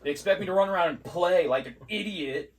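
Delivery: high, loud sarcastic rant; hammed-up indignation, hitting each item hard; mock complaint. Mock rant about easy school day.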